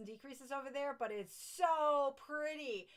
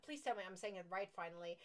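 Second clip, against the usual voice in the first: ordinary talk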